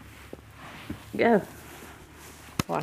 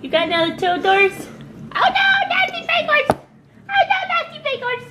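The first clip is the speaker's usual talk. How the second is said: overwhelmed by cuteness; 4 dB louder than usual. Real, not acted. Squealing at baby's toy horse.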